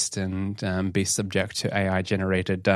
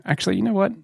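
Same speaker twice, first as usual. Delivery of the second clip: ordinary delivery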